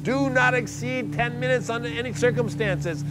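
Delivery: high, overheated, agitated telling; rising strain in a sweltering tent; panting distress. Retold or imitated. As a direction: tell it as if overcome by steam.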